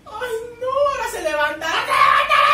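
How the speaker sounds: high, loud rising screams; excited screaming. Real, not acted. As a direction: scream as the kiss is coming. Fan shouts as kiss nears.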